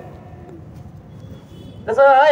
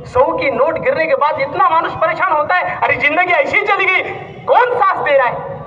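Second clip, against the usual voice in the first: raised, impassioned sermon voice, pressing the crowd; fervent preaching; 5 semitones higher than usual. Acted. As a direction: preach it out, hammering each question. Preaches at full force.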